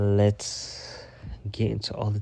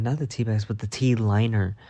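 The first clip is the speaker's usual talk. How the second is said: ordinary delivery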